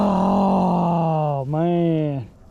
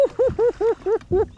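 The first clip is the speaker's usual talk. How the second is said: high giddy laugh; 13 semitones higher than usual. Real, not acted. Laugh after catch.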